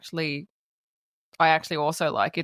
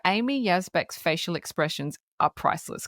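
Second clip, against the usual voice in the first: normal talking voice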